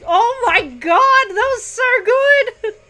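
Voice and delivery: slow, drawn-out gushing in awe; awed raving. Real, not acted. Fan gushing slowly over a great action scene. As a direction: savour every word of praise.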